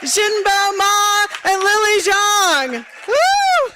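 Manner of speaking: loud, high, stretched-out crowd hype; hype announcer. Real, not acted. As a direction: pump up the room for the champions. Host hypes crowd for champions.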